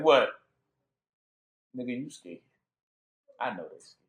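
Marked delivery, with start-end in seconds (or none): 1.8-4.0 much softer and lower, knowing murmur; hushed thought. Retold or imitated. The elect's silent thought, softly murmured. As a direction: say it like a private judgement. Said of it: "the elect is only thinking one thing".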